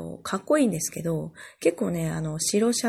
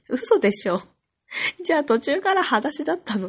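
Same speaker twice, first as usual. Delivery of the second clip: louder, rising mock disbelief; an exclaimed can't-believe-it reaction, replayed; retold incredulity; 7 semitones higher, 5 dB louder than usual. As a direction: act out astonished questioning of grandpa. Retold disbelief at grandfather's barefoot walk.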